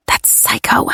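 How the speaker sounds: soft breathy whisper of disgust; whispered insult. Acted. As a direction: hiss it under your breath. Muttered inner insult.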